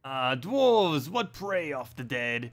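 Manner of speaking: drawn-out exclamation settling into storybook dwarf talk; hammed-up dwarf character; dwarf quest character. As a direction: play an indignant dwarf in a game quest. Voices dwarf in quest dialogue.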